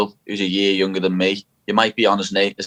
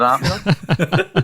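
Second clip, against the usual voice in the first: laughing; 7 semitones higher, much slower than usual. Real, not acted. Laughing at his team's slump.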